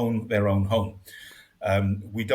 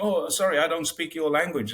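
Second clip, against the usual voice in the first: higher-pitched apologetic driver quote; politely begging off, voice lifted; 6 semitones higher than usual. Retold or imitated. Taxi driver excusing himself to a foreign passenger, apologetic.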